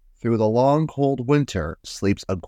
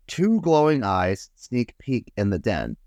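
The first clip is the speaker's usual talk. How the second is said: ordinary delivery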